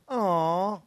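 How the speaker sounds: a soppy cooing aw over puppies; cooing radio DJ. Retold or imitated. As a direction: coo it like a sentimental radio host. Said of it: "the guy does one of these".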